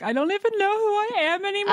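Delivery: high, whiny mock sob to her husband; mock crying. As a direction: wail it in a hammed-up teary falsetto. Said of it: "crying to my husband yesterday".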